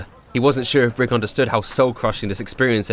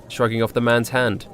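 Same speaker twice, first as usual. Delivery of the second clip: plain reading voice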